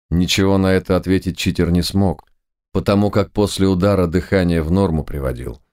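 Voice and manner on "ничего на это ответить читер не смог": ordinary delivery, nothing marked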